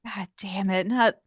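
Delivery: ordinary delivery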